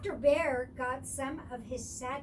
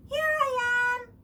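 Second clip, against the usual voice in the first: high, loud happy shout; 11 semitones higher, 11 dB louder than usual. A narrator's reading. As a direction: call back across the snow. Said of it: "shouted Baby Deer".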